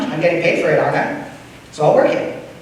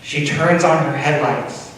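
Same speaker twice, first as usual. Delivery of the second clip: ordinary delivery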